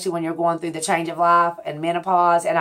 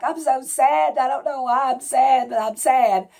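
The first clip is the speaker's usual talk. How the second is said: higher, whiny put-on sad voice; mock sad whine; 7 semitones higher than usual. To viewers, mocking her own tearful self, in a whiny sad voice. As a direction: imitate your own weepy self, half-joking. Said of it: "I was crying and like".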